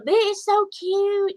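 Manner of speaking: a thrilled little coo over something cute; gushing, high and slow. Retold or imitated. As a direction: squeal it out slowly, high and delighted. Gushing over a new doll.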